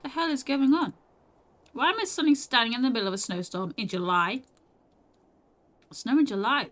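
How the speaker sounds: loud bewildered outburst. Acted. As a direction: shout your disbelief at the storm. Stunned by the snowstorm.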